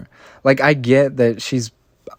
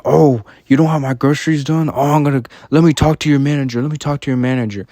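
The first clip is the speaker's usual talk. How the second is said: raised, louder mock-customer outburst; mock indignant demand; 4 semitones higher, 3 dB louder, faster than usual. Worker mimics a demanding grocery customer, mock indignant. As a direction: imitate an entitled complainer, higher and rushing. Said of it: "never gonna tell people like".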